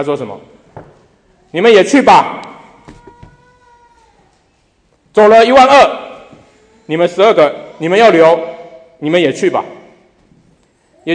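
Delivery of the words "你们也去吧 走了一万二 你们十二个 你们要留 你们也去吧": louder, higher, bold dare to leave; stronger challenge. Retold or imitated. Pastor voicing Jesus daring the twelve to go, loud challenge. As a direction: throw the door open for them. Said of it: "他就再强烈一点".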